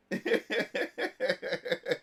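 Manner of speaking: breathy staccato chuckling; laughing. Real, not acted. Streamer remembering game nights, breathy laughter. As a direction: laugh to yourself at a memory.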